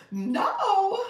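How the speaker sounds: high, loud, laughing "No?"; amused disbelief. Real, not acted. Host teasing a viewer who said no, laughing high and loud.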